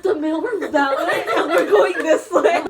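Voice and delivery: loud, laughing protest; exasperated through laughter; laughing exasperation. Retold or imitated. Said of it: "I was like".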